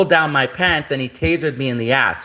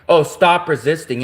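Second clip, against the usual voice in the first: normal talking voice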